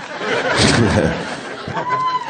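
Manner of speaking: squeaky startled laugh; high nervous laugh. Real, not acted. Comic on stage laughing off a near vomit, high laugh.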